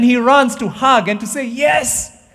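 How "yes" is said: joyful father. Acted. Father voiced rejoicing.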